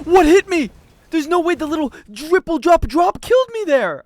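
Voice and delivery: loud, high, indignant outburst; outraged disbelief. Real, not acted. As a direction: rant at the unfair death. Outraged after death.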